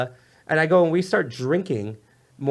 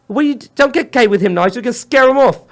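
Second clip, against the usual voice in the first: higher, louder mock-scolding British wife; hammed-up telling-off of her husband; 9 semitones higher, 8 dB louder than usual. Wife scolding husband Nigel for kissing the guest, mock British.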